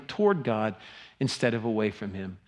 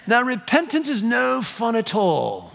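mock English accent, higher and slow; 11 semitones higher, 4 dB louder than usual. Shown as a demonstration. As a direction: read it like a stuffy English don. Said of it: "Should I say that with an English accent?".

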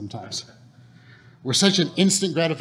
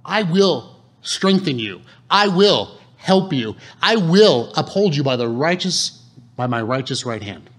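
slow, louder, stressing each I will; slow emphatic promise; 5 dB louder than usual. Acted. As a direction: preach the promise word by word.

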